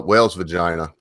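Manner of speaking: normal talking voice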